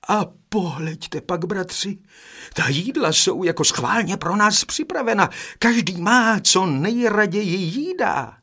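a brother excitedly pointing out the meal; exclaimed. A narrator's reading. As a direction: call out the wonder to the brothers. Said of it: "zvolal druhý".

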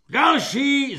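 loud bawled "Další"; bellowed. A narrator's reading. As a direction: holler the next name. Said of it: "zahulákal svobodník".

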